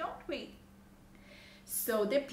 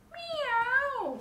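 very high, loud meow; cat's meow; 14 semitones higher, 9 dB louder than usual. A narrator's reading. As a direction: meow out like a storybook cat. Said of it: "said the cat".

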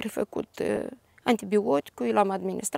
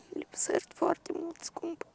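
a grieving whisper, almost no voice; 6 semitones higher, 7 dB quieter than usual. Real, not acted. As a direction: whisper it as if to the grave. Grandmother addressing lost granddaughter. (șoptit, cu durere)

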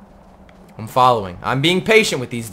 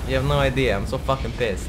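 normal talking voice

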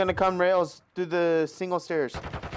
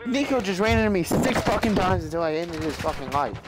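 hyped gamer bro; 5 semitones higher, 4 dB louder than usual. Real, not acted. Ranting about teammate Nico.

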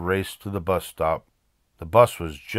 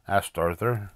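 plain reading voice

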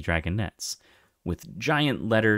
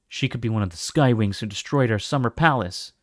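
usual speaking voice

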